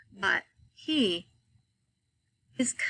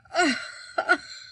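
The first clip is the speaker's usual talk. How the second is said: heaving wordless sobs from deep in the chest; demonstrated weep, voiceless heaves; mock weeping; 7 semitones higher than usual. Shown as a demonstration. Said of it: "deep from in your chest".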